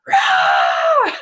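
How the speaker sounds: deep, loud mock baby roar; a short growling baby yell, hammed up; imitated baby roar. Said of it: "All you hear is, like".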